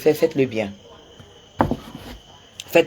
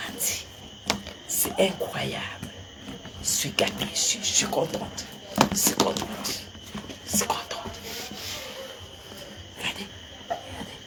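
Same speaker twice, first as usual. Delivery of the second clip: breathless, soft whisper, much softer; real moved whisper; 2 semitones higher, 13 dB quieter than usual. Real, not acted. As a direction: whisper your joy, overwhelmed. Moved, murmuring her thanks.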